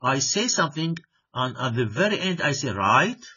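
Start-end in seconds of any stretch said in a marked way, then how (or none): 2.7-3.2 "Right" louder, with a questioning rise; "Right" as a check question, pitch swinging upward; rising intonation. Shown as a demonstration. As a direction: lift "Right" sharply at the end, expecting an answer. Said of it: "this type of rising intonation".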